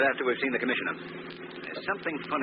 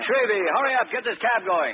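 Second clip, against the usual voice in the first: impatient call; 9 semitones higher, 5 dB louder than usual. Acted. Cranston calling cabbie to hurry.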